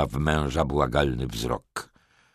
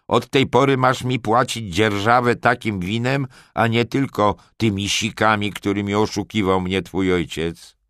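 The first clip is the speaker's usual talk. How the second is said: loud, raised-pitch lordly demand, easing slightly; krzyk; 6 semitones higher, 5 dB louder than usual. A narrator's reading. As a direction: lay down the law to a tenant. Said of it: "krzyknął znienacka". (głośno, władczo, podniesionym głosem)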